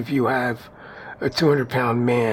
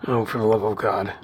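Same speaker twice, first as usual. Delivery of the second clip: sarcastic; 2 semitones lower than usual. Pretend. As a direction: put on sarcastic dismay. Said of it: "I say that with complete sarcasm".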